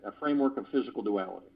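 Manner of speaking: low, quick tongue-twister run-through; fast repeat. Shown as a demonstration. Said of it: "ten times fast".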